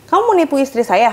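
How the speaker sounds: loud, fast accusing question; angry accusation. Retold or imitated. Husband accusing the technician of cheating his wife, angry. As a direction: fire it at him quickly.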